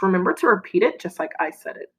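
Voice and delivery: ordinary talk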